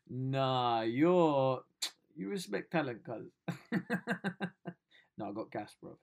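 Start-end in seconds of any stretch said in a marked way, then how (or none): none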